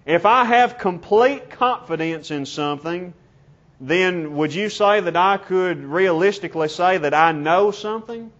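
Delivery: normal talking voice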